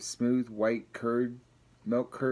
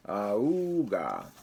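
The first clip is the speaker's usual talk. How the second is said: bagpipe honk. Acted. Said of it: "And the bagpipe said".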